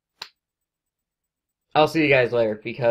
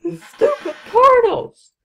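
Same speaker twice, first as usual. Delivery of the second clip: high, loud, slow laughing rant; 9 dB louder, slower than usual. Real, not acted. Gamer curses the portals, high and loud while laughing. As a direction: drag out the complaint while laughing. Cursing the portals after dying.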